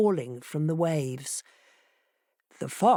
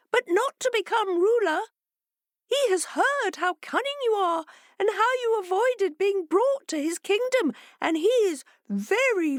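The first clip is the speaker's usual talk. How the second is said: much higher, smug fish voice; fishes saying. A narrator's reading. Fishes revealing the plan, high and smug. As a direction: announce it smugly.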